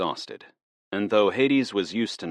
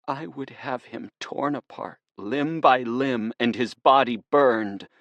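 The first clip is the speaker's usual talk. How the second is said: shaking with menace; 7 semitones higher than usual. Acted. Lara describing Zeus's punishment to Hades, menacing. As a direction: savour the revenge, trembling with hate. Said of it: "her voice shaking with menace".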